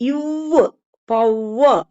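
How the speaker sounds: இவ் held, then பவர் with a firm W; pressed W. Shown as a demonstration. Teacher stressing the middle W for learners, pressed. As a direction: lean on the W sound in பவர். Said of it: "அழுத்தி சொல்லிக்கணும்".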